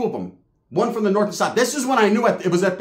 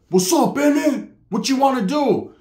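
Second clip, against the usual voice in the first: challenging call-out; 6 semitones higher than usual. Retold or imitated. Cousin challenging rival from car.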